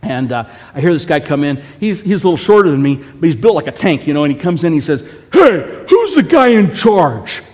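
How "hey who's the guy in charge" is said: loud, high confrontational shout; mock furious challenge, stretched out; imitated angry demand. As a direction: demand the boss like a furious tough guy. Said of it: "And Polo's furious".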